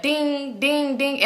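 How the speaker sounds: slow, ringing "ding" repeats; imitated phone dings. Friend's phone going off in the car, imitated dings. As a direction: mimic a phone chiming over and over.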